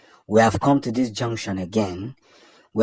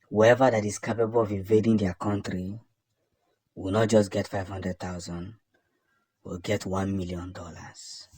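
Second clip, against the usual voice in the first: ordinary talk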